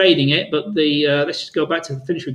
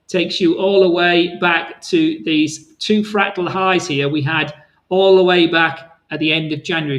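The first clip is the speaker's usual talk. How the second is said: ordinary talk, nothing marked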